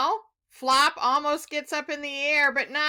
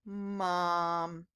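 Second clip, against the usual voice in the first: soft tender mom; 7 semitones lower, 6 dB quieter than usual. A narrator's reading. Gentle reveal.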